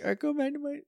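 put-on high, soft timid girl. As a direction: squeak it out like a shy girl. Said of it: "she's like".

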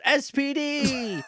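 high, drawn-out heroic battle cry; mock-triumphant cartoon hero call; He-Man. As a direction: hold up the sword and proclaim it slowly. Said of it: "Like he's He-Man".

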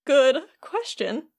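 slow, drawn-out bluffing; stalling for time. Retold or imitated. Imitating Donald asked the castle's name, stalling. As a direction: act out someone hunting for an answer they lack.

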